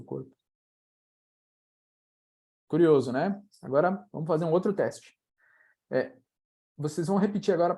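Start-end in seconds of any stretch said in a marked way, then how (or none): none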